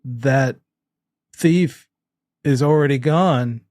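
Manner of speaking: halting, stilted bad acting; word-by-word, long pauses, painfully staged; Gary. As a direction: read it out like a hopeless actor. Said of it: "'cause Gary does not".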